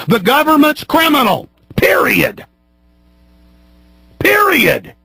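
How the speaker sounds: high, emphatic angry cry; furious verdict. Real, not acted. Host condemning the government, furious verdict. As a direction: hammer it out as the final word.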